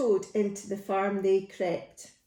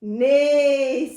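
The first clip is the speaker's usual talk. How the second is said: loud, long, raised neigh; neighing horse; 4 semitones higher, 8 dB louder than usual. Acted. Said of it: "said the horse".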